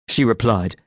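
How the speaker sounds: ordinary delivery, nothing marked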